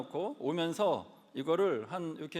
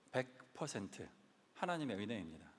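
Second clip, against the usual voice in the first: 하나님의 은혜입니다 said softer and lower, dropping the voice; quiet confession; 5 semitones lower, 7 dB quieter than usual. Real, not acted. Pastor quietly crediting God before the congregation, hushed. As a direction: murmur 하나님의 은혜입니다 almost to himself. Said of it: "원 헌드레드 퍼센트 하나님의 은혜입니다".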